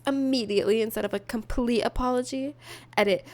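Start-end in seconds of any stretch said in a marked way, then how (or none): none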